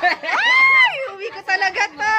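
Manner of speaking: high, loud squeal of delight. Real, not acted. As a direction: shriek with joy over the gift. Thrilled by gift. (tili sa tuwa sa regalo)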